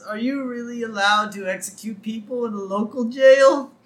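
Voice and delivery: high, plaintive questioning; worried whine. Retold or imitated. Prisoner to reverend before execution, worried whine. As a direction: ask anxiously like a meek prisoner.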